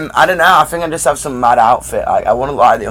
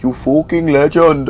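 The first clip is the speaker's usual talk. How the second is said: mock non-British fucking legend, slow; imitating a foreigner fumbling the English you fucking legend; a lightly mocked, slower, off-sounding You fucking legend; bad foreign attempt at slang; slower than usual. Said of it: "when someone tries to do it in Europe".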